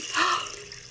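quick, high, breathless gasp of shock; sharp gasp. A narrator's reading. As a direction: catch your breath in surprise. Narrator reacts to Jesus washing feet.

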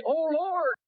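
high, rising plea to the Lord; pleading prayer. Pretend. Preacher voices the congregation's prayer, high plea. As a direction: surrender yourself in prayer. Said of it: "You ought to say".

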